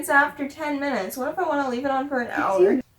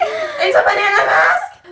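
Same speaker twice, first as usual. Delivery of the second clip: high, drawn-out incredulous squeal; mock excitement; 10 semitones higher, 3 dB louder than usual. Repeating a friend's banana mask discovery, mock excitement. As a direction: squeal it slowly through a laugh.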